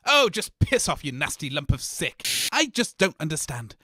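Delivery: normal talking voice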